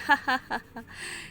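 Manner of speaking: light giggle of the fish; a quick amused laugh; spotted fish laughs at the pirate. Acted. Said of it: "वह हंसने लगती है".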